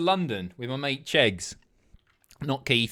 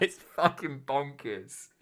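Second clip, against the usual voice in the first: normal talking voice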